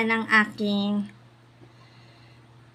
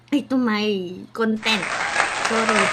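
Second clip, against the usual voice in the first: usual speaking voice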